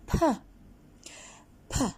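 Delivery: P said as a puff of air; puffed P sound. Shown as a demonstration. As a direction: blow out "P" like a candle. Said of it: "you puff it and you say".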